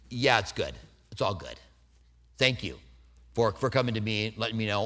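soft, low kind acceptance; gentle reassurance. Shown as a demonstration. Preacher modelling forgiveness, softly. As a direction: reassure them quietly. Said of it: "I can say".

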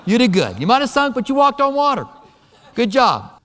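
high-pitched, hyped-up praise; mock congratulation. Jesus imagined praising Peter for walking on water, playful mock congratulation. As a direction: cheer him on in a light, playful mock voice.

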